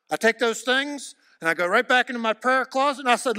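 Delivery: fervent sermon voice; preaching at full force. Acted. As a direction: drive it home to the crowd.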